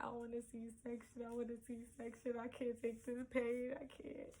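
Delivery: breathy crying plea trailing into a whisper; begging through pain, near tears; tearful plea. Retold or imitated. Crying to mother in labor pain.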